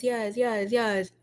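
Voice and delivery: normal talking voice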